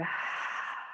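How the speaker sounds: soft, drawn-out breath out; long exhaled sigh. Shown as a demonstration. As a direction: let all the air go in one long, quiet sigh. Demonstrates letting the breath go.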